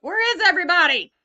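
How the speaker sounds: loud, high, fast call to an empty room. Real, not acted. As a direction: shout out for missing viewers. Calling out to an empty livestream.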